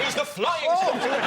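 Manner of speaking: excited quip. Real, not acted. Joke shouted over panel.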